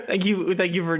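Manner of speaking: talking through laughter; laughing. Real, not acted. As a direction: thank them while cracking up. Cracking up while thanking Noah.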